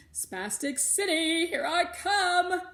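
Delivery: loud, high, slow singsong; gleeful. A narrator's reading. Melody announcing her tornado, loud singsong glee. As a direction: sing out the coming storm.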